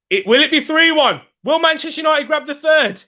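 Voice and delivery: high, urgent commentator question; excited match commentary. Real, not acted. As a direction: throw the question up high to the audience.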